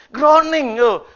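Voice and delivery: groaning. Shown as a demonstration. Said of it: "Ngerang gitu, groaning".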